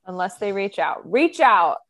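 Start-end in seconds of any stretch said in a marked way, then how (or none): 1.1-1.8 loud, higher-pitched call; mock calling out. Co-host to host's secret admirers, playfully calling out. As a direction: call it out playfully to someone far off.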